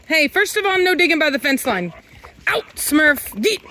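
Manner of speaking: high loud reprimand; firm, loud, telling off the digger; scolding dogs. Real, not acted. Owner scolding the dogs for digging by the fence, high and loud.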